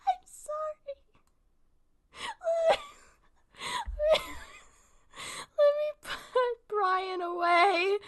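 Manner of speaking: high, squeaky, breaking laughter; crying with laughter. Real, not acted. Streamer trying to carry on after blunder, crying laughing. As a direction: struggle to talk through real laughing tears. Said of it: "I'm crying though".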